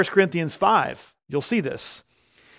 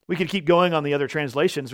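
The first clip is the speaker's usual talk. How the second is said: ordinary delivery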